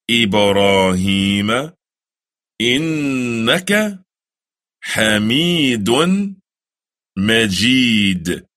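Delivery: slow, chanted Arabic recitation; prayer recited in Arabic. Shown as a demonstration. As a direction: recite each word slowly and reverently.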